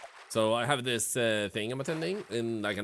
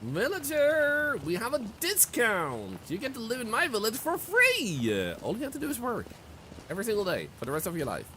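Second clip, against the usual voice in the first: pushy recruiter voice; 11 semitones higher than usual. Pretend. Calling out to recruit villagers.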